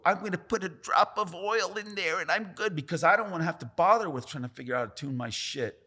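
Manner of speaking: high, loud mocking lazy voice. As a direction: sneer it like a lazy hack. Mocking lazy rotary tattooers.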